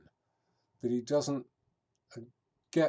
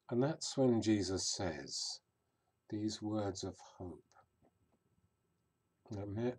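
ordinary talk, nothing marked

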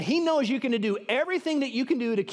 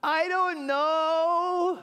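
very slow, high drawn-out wail; 8 semitones higher, much slower than usual.

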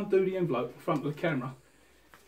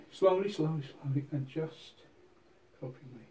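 hypnotic; 3 semitones lower, 9 dB quieter than usual. Acted. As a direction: draw her into a trance softly. Hypnotic instruction to copy movements.

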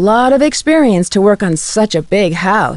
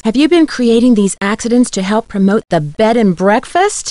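ordinary talk

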